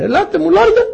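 high-pitched summons, rising at the end; calling out. Acted. Noah summoning animals into the Ark, calling out. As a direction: call the animals over in a high voice.

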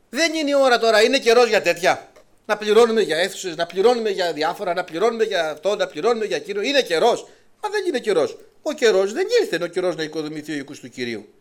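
loud, high sarcastic imitation of excuses; preacher mocking stingy believers, loud and sarcastic. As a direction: ridicule their complaints about paying.

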